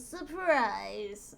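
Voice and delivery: drawn-out cartoon villain reveal; half-hearted scream, stretched out theatrically; mock scream. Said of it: "and he screams".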